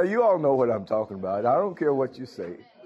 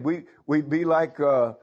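usual speaking voice

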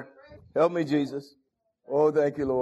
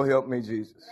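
ordinary delivery

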